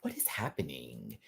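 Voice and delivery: breathless whispered disbelief; stunned whisper. Retold or imitated. Quoting Jasmine's reply in a stunned whisper. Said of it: "quoting Jasmine".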